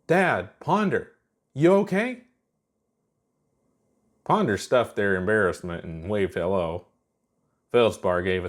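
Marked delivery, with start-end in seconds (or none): 0.1-2.2 raised voice. A narrator's reading. Chuck calling into the crashed cockpit, raised and high. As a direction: call through the window, worried. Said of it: "She raised her voice so they could hear".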